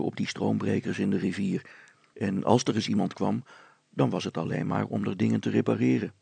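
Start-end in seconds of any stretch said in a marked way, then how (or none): none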